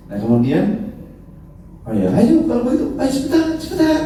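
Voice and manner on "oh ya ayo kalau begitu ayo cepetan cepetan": much higher, hurried urging; eager Idris. Retold or imitated. Idris urging the angels to hurry, high eager voice. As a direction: rush them along, high pitched.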